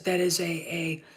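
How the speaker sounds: usual speaking voice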